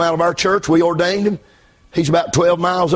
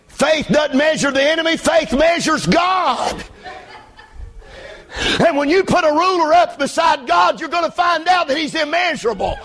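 high, shouted sermon climax; full-force preaching; 11 semitones higher than usual. Real, not acted. Pastor telling church faith measures God, shouted preaching. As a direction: build to a shouted pulpit command.

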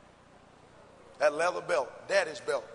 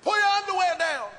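stern mother order; 9 semitones higher, 6 dB louder than usual. Retold or imitated. Mother ordering before whipping.

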